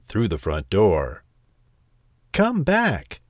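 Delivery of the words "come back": high-pitched cry after a runaway. A narrator's reading. Old woman calls after the fleeing gingerbread man, high cry. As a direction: call out high, without much extra volume. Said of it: "cried the little old woman".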